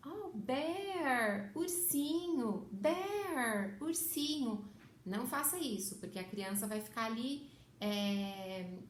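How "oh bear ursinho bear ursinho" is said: higher sing-song, switching bear and ursinho; mixed-language baby talk. Shown as a demonstration. A mother wrongly mixing both languages for her baby, higher sing-song. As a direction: coo both words to the baby in turn. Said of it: "Você não vai precisar falar".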